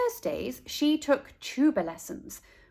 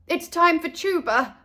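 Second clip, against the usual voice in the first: flustered nanny; 6 semitones higher, 4 dB louder than usual. A narrator's reading.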